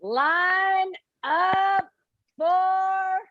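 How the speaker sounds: high call across the cell block, held back; first try at the yell, not full force; guard's shout. Acted. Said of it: "shouts out".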